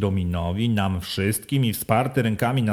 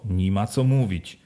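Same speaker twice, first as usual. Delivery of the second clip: ordinary delivery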